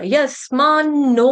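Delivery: यस्मान्नो drawn out slowly, holding the double n; slow teaching recitation of यस्मान्नो with a doubled न; double n demonstration. Shown as a demonstration. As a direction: stretch यस्मान्नो syllable by syllable, long final o. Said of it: "double न".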